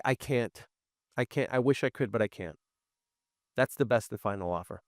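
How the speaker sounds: soft, firm, regretful no; firm refusal. Shown as a demonstration. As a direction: close the door gently but firmly. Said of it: "You just say".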